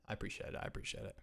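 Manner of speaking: breathy whisper under his breath; whispered thanks. Real, not acted. Streamer whispering thanks to his chat. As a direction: whisper a private thank-you.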